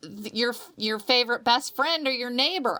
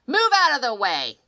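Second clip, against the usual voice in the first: put-on louder, confident brash kid voice; bold kid; 5 semitones higher, 9 dB louder than usual. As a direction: barrel through like a fearless child. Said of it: "you are the little child that just".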